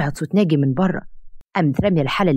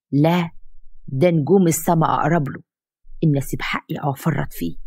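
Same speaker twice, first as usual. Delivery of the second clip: plain reading voice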